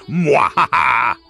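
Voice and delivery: a gloating mad-scientist laugh; evil laugh. Acted. Villain finishes his speech.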